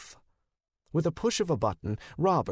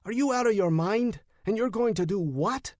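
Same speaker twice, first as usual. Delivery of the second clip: pitched-up outraged demand; yelling; 8 semitones higher than usual. A narrator's reading. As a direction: yell at a reckless friend. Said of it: "he yelled".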